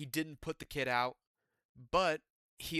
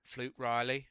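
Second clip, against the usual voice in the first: bad British accent; 2 semitones lower, slower than usual. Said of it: "I'm not gonna do the British accent anymore".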